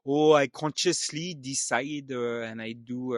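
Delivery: put-on halting, heavily French-accented English. Telling what my son said to Papa, in a put-on French accent. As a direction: put on a French accent, drawn out with many uhs. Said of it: "exactly what I did now".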